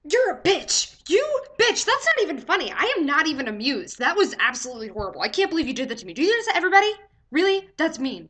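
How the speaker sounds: loud, high-pitched indignant rant; outraged outburst. Retold or imitated. Patient to nurse after cruel pregnancy prank, outraged. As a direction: let the prankster have it, voice leaping up.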